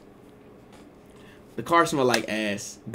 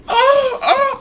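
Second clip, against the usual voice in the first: mock giggling squeal; 6 dB louder than usual. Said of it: "started jumping and bouncing".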